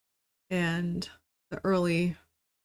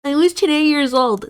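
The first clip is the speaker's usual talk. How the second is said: high, loud mocking voice; mocking quote voice; 8 semitones higher, 8 dB louder than usual. Mocking people who use the phrase, high and loud. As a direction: sneer it in a put-on high voice. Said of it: "That's not an age".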